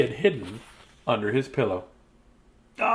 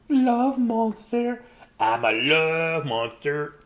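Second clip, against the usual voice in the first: monster voice; 2 semitones higher, much slower than usual. Acted. Reading to kids, the monster introduces himself in a slow voice. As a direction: stretch it out like a cuddly monster.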